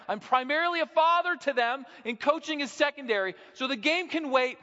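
fiery pulpit declaration on fathering; full-force preaching. Acted. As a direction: proclaim it like a revival preacher.

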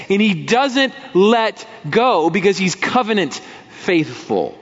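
ordinary delivery

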